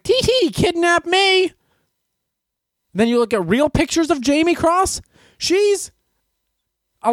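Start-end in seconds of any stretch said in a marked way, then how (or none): 0.0-1.5 put-on high, slow, giggly little-girl voice; mock coy girl. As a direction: play the teasing helpless girl, coyly. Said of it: "being like".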